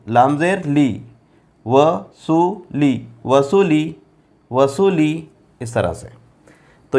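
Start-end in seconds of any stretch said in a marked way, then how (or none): 1.7-5.3 each syllable stretched, very slow; slow syllable demo. Shown as a demonstration. Teacher spelling out वसूली for students, very slow. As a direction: sound it out slowly. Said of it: "वाव ज़बर व".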